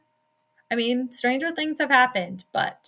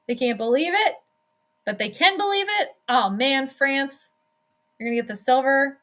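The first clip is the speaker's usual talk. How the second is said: usual speaking voice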